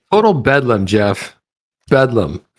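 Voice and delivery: loud. Real, not acted. Relishing the word bedlam.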